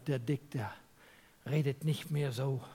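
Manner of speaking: normal talking voice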